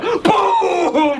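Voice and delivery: vocal sound effect of a breath attack; drawn-out cartoon boom; imitated explosion. Imitated blast of dragon breath.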